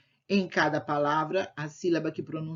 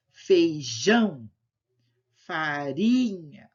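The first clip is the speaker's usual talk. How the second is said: each word alone, strong syllable stretched: feiJÃO, faRInha, macarRÃO, Óleo, boLAcha, LEIte, faROfa; slow word list, leaning on the stressed syllable of "feijão", "farinha", "óleo"; exaggerated syllable stress; much slower than usual. Shown as a demonstration. Teacher hinting the strongest syllable to pupils, stretching it in "feijão", "bolacha", "farofa". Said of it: "falou com um tom já indicando qual é a sílaba mais forte".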